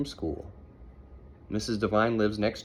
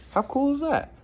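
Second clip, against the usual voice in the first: much higher, louder delighted question; excited aside; 5 dB louder than usual. Real, not acted. Parent excited aside to child.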